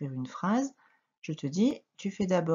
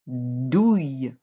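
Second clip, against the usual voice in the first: lower, loud, drawn-out syllable; dictation; 4 semitones lower, 5 dB louder than usual. Shown as a demonstration. Teacher dictating a syllable to a pupil, low, loud and drawn out. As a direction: sound the syllable out firmly for writing. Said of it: "La troisième syllabe".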